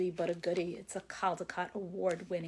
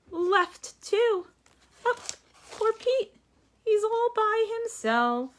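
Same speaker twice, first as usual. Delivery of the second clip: higher, louder exaggerated pity for Pete; mock sad; 7 dB louder, slower than usual. Teacher pitying lonely Pete with a hammed-up voice. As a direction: ham up the sadness for the kids.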